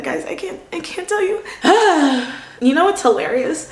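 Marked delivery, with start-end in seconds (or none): 0.0-2.3 overwhelmed. Real, not acted. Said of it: "overwhelmed gratitude".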